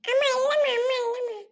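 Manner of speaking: put-on high, urgent child denial; pleading little boy. Accused boy denies it to mother, high pleading whine. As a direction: wail your innocence in a small voice.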